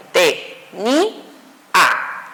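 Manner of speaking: te... NI... a, each syllable alone. Shown as a demonstration. Teacher dividing the word, "te, ni, a" said as separate syllables. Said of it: "la palabra quedaría dividida en".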